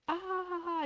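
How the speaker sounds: high, soft, drawn-out "ah"; imitated bird cry. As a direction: mimic the bird's mocking cry, lightly. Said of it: "Il faisait comme ça". (cri d'oiseau moqueur imité)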